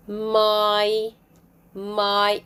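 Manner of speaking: "my" with a held "eye" vowel; long I. Shown as a demonstration. Teacher gives example words, long I. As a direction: draw out the vowel in "my". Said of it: "words with long I sound".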